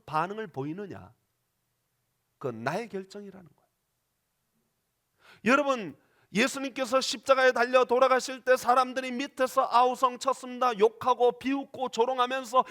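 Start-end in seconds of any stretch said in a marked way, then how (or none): none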